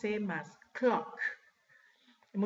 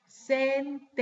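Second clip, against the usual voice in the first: slow, louder SEN-TEN-CES, each part separated; syllable-by-syllable; 4 semitones higher, 4 dB louder than usual. Shown as a demonstration. Teacher giving class the English word for oraciones, syllable-by-syllable. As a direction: break the word into syllables for learners.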